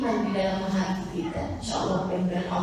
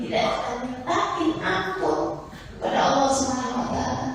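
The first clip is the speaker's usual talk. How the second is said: normal talking voice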